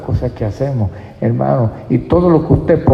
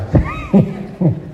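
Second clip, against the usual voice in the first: laugh. Real, not acted. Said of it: "laughs at his pun".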